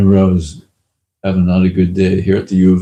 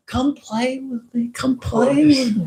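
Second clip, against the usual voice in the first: a hint of divine invitation. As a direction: coax gently like a playful child. Krishna calling in the vision.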